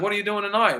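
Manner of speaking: high-pitched, lightly imitated South African accent. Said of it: "he talked with a South African accent".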